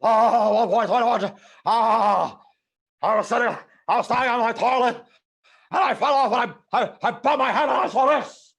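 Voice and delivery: very high, loud, hammed-up yelling; frantic Doc. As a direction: yell it in wild, stammering excitement. Acting out Doc's toilet story.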